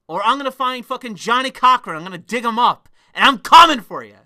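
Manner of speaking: loud, high-pitched furious rant; angry threat. Real, not acted. Angry threat of legal action.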